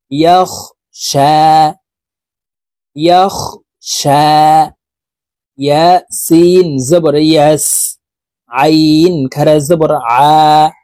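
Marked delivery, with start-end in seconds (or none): none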